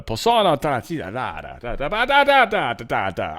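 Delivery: singsong fake Italian, swooping up and down; mock Italian gibberish. Vlogger fumbling the Italian MP's speech, mock Italian gibberish. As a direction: babble in a mock Italian lilt. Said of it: "I don't know how to".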